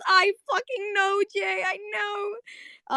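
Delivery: very high, stretched-out squeal; excited agreement. Real, not acted. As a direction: wail agreement with a fellow fan, overwhelmed. Squealing agreement with chat comment.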